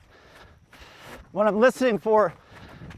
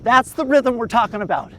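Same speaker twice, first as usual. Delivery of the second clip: ordinary delivery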